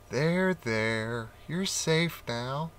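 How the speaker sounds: put-on soft, slow comforting to a scared child; mother rabbit comforts her son, softly soothing. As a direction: hush him gently.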